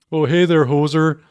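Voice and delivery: mock Canadian, friendly and playful; Canadian accent. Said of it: "do it in, in Canadian".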